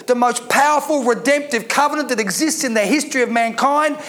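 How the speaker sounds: ordinary delivery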